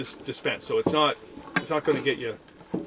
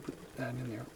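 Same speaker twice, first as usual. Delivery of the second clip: ordinary talk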